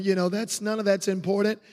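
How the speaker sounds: ordinary delivery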